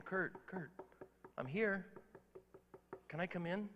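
soft, quiet call by name; gentle call. Retold or imitated. Jesus quietly calling Kurt at the door. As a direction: call him softly at the door.

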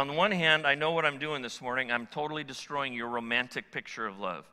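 ordinary talk

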